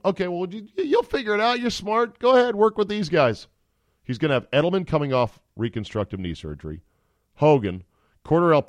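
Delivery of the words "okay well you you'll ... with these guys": high, mocking voice of a team brushing off its quarterback; mock pep talk. Team telling Brady to make do, in a high mocking voice. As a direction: talk him down like a patronising boss. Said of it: "Tom Brady is being told".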